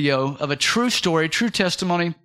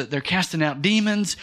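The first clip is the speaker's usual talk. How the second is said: ordinary delivery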